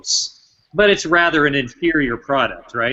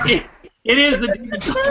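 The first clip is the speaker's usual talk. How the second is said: excited reveal; 11 semitones higher, faster than usual. Real, not acted. Quiz host reveals the answer.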